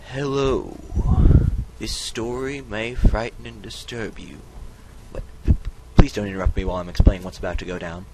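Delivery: loud, booming storyteller opening; dramatic narrator voice. Acted. Vlogger opens a spooky tale, booming narrator voice. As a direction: boom it out like a grand announcer. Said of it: "the most dramatic reading ever".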